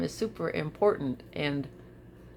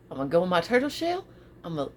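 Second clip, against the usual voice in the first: ordinary talk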